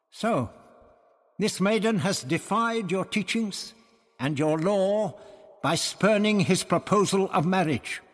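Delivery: high, pointed challenge in lightly acted dialogue; challenging Barbara. A narrator's reading. Barbara confronts the priests over the maiden, challenging. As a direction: press the accusation, pitched well up.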